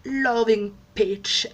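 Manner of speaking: self-mocking English accent on Loving Peach; playful mock English accent; fake English accent. Shown as a demonstration. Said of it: "avec mon accent super anglais qui n'est pas anglais".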